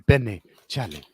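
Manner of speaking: Benny, Charlie in a low put-on African accent; African accent demo. Shown as a demonstration. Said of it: "practice it in the mirror every night".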